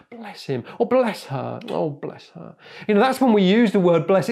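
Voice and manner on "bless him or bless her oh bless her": softly pitying and condescending; mock sympathy, trailing away quietly; patronizing. At least he's trying, patronizing. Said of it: "pathetic and powerless and patronizing".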